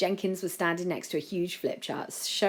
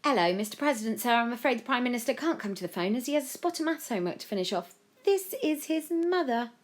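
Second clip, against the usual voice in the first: higher, chatty mother on the phone; Joe's mum; 8 semitones higher than usual. A narrator's reading. Mum makes excuses to the President, politely chatty. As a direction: put on a polite telephone manner.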